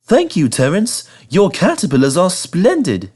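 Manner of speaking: high, grateful little engine. Acted. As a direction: thank his rescuer gladly. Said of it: "said Thomas gratefully".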